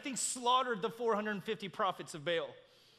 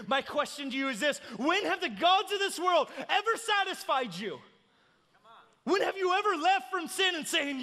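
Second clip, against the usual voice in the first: raised, driving sermon delivery; impassioned preaching; 8 semitones higher, 6 dB louder than usual. Real, not acted. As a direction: preach it with mounting urgency. Preaches at full force.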